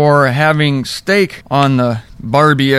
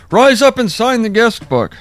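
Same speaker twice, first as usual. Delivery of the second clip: higher, playful order; mock command to a corpse; 8 semitones higher than usual. Shown as a demonstration. Preacher jokingly ordering a corpse to rise, playful command. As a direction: bark it cheerfully at the corpse. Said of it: "That's what I would say".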